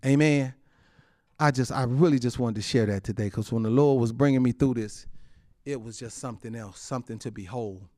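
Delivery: ordinary delivery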